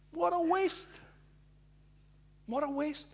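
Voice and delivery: high, scornful scoff; indignant complaint. Acted. Pious guests scoffing at the woman, high and scornful. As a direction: sneer it like a pious snob.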